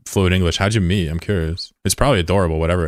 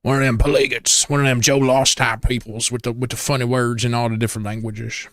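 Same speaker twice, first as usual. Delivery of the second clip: high-pitched hammed-up character voice; put-on accent; 5 semitones higher than usual. Streamer showing off accents, in a hammed-up high voice. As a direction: put on a silly higher voice with an accent. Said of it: "I can do accents very well".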